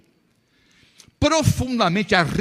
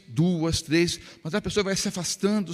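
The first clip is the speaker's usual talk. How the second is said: normal talking voice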